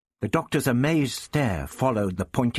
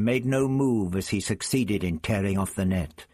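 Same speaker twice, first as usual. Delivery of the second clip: plain reading voice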